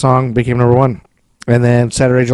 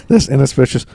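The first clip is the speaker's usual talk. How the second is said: usual speaking voice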